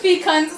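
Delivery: fecons said fee-kons, long ee, matter-of-fact; corrected fecons, fee-kons, with a laugh of relief; settled pronunciation. Shown as a demonstration. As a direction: land on fecons as fee-kons, confident now. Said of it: "See, thank you, librarian".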